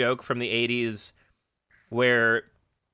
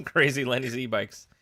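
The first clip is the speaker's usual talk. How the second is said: ordinary delivery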